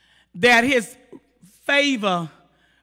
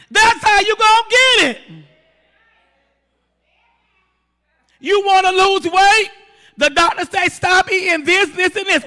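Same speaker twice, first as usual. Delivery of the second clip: raised, driving pulpit exhortation; forceful, emphatic preacher; full-force preaching; 5 semitones higher, 5 dB louder than usual. Real, not acted. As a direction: press the congregation hard, pitch held up.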